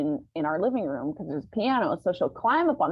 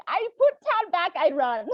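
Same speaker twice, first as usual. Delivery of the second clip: high, loud mock panic; a hint of frantic guest; housekeeper voice; 9 semitones higher, 6 dB louder than usual. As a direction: lightly play the scared housekeeper. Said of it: "She's like".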